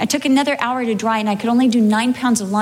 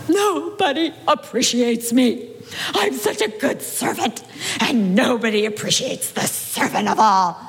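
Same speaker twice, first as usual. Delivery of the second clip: self-pitying wail. Retold or imitated. Pastor's wife lamenting to husband, whiny self-pity. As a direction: moan like the unappreciated servant. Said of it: "That made me wail all the harder".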